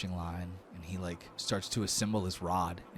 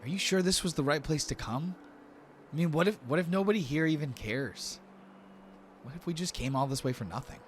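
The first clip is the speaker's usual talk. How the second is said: high-pitched, doubtful companion; worried Lortho; 9 semitones higher than usual. Acted. Lortho asks if they came all this way for nothing, worried high voice. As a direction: voice lifted high with worry.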